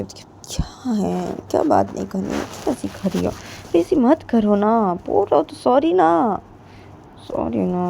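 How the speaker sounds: soft, wheedling appeal; pleading. Acted. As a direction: coax softly with lingering words. Pleading with angry friend. (प्यार से धीरे-धीरे मनाना)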